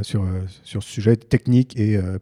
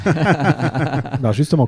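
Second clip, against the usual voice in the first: laughing through the words, slow and a bit higher; 5 semitones higher, much slower than usual. Real, not acted. Host still laughing at the guest's joke, laughing. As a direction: let the laugh carry the words.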